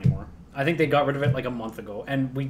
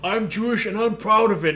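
higher-pitched mock indignation; imitating someone standing his ground; defiant retort; 6 semitones higher than usual. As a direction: lightly act out a proud comeback. Acted defiant reply in retold quarrel.

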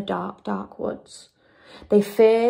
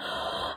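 sharp intake of breath; gasp; 10 dB quieter than usual. A narrator's reading. Friends spotting a light in the cabin, gasping. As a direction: gasp in astonishment.

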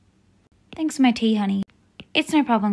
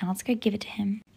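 sneaky whisper; 8 dB quieter than usual. Acted. Sneaking off with prank cookie.

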